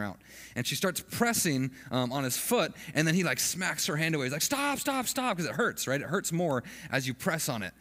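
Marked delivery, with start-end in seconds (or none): 4.4-5.4 high-pitched retold yelp at normal volume; mock yell. Brother fending off mom's credit card, high mock yell. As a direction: imitate a panicking brother, pitched way up. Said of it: "And he's screaming".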